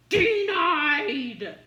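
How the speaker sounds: loud, high game-show "Denied!"; mock rejection shout. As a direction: bellow it like a buzzer verdict. Another failed attempt, mock rejection.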